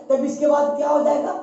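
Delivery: ordinary talk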